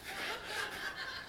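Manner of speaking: a short, high laugh. Real, not acted. Pastor laughing at his own turtle story, high. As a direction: laugh at the punchline.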